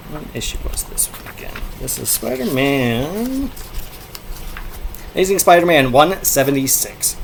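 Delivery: ordinary delivery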